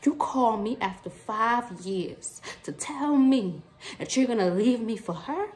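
ordinary delivery

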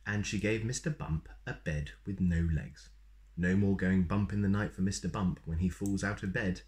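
ordinary delivery, nothing marked

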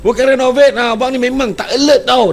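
high, loud scolding wife voice; wife scolds husband for not noticing; angry wife. Retold or imitated. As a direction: snap at him like a fed-up wife. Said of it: "dia kata, marah dia".

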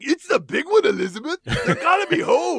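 high, loud hammed-up panic with a laugh; fake heart attack. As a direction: clutch your chest and ham it up. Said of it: "full heart attack mode".

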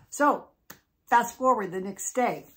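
usual speaking voice

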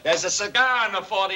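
ordinary talk

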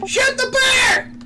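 high frantic shouting; being chased. Real, not acted. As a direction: yell orders in a panic. Mob fight, screaming orders.

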